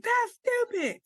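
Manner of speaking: hammed-up protest at the trainer; dramatic protest. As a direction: scoff at it like a drama queen. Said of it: "and also I'm very dramatic".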